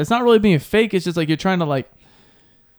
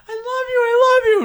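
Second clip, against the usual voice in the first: very high, fake-crying I love you; mock sobbing. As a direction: blubber it like a heartbroken fan. Imagined teammate sobbing love.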